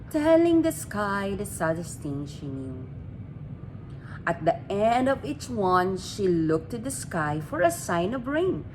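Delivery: plain reading voice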